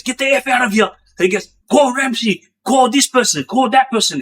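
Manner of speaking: mimicking an inmate going off at the governor; retold furious rant. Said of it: "He was going off".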